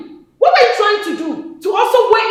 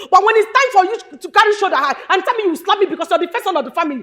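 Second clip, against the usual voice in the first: ordinary talk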